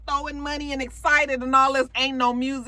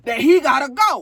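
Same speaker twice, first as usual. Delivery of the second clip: loud, imitated tough friend; mock tough-girl announcement; big strong enforcer; 3 semitones higher, 6 dB louder than usual. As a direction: throw your weight around, loudly. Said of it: "the big Brutus one, you know, the big strong one".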